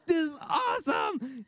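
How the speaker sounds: tearful, ecstatic yell; freaking out. Retold or imitated. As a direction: cry it out in overwhelmed joy. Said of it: "started freaking out".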